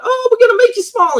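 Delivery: very high, sing-song mock character voice; Mama Leota voice. Mama Leota greeting riders, very high sing-song. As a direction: trill it like a cheery ride character. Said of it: "Mama Leota comes out".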